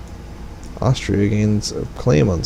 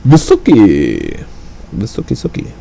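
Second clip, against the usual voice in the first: silly sing-song call. Pretend. Playful silly name call.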